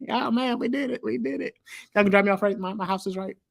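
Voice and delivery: high, excited Chris Tucker impression; playful high-pitched movie quote; imitating Chris Tucker. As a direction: squeal it like Chris Tucker in the helicopter. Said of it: "There's this scene".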